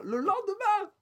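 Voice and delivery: excited high squeal. Pretend. Escalated repeat.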